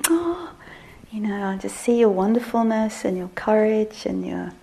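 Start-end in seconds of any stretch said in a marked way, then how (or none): 0.1-0.5 tender admiring oh. Retold or imitated. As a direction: melt with admiration. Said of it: "I'm like".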